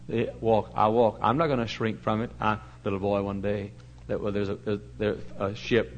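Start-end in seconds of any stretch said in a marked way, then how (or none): none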